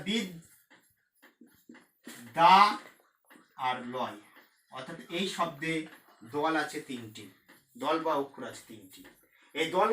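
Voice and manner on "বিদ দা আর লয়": "বিদ", "দা", "লয়" with long pauses; split into syllables. Shown as a demonstration. Teacher dividing a word for students, split into syllables. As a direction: separate "বিদ", "দা" and "লয়" clearly. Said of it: "উচ্চারণ করতে গেলে".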